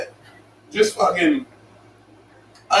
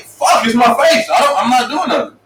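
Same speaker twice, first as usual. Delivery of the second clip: exasperated shout; 9 semitones higher, 4 dB louder than usual. Retold or imitated. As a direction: yell it like a kid fed up with being blamed. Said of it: "I'm like".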